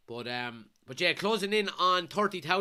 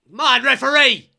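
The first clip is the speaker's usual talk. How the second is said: a furious yell at the referee, much higher and louder; angry shout; 9 semitones higher, 16 dB louder than usual. Real, not acted. Fan yelling at the referee over a foul, furious shout. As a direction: bellow in protest at the ref. Protesting a foul during the match.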